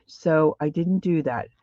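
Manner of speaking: ordinary delivery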